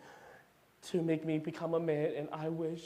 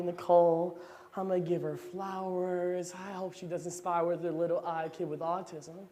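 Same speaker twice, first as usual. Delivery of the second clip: normal talking voice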